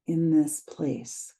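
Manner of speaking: calm guided meditation read, unhurried pauses. Acted. Teacher guiding listeners through a grounding practice, meditation voice. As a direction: lead them gently into the body.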